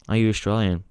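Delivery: ordinary talk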